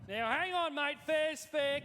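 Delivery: ordinary talk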